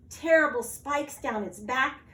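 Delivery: ordinary delivery, nothing marked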